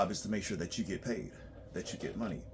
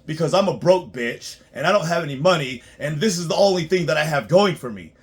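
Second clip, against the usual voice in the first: mocking a broke client; 4 semitones higher, 9 dB louder than usual. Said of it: "they're like".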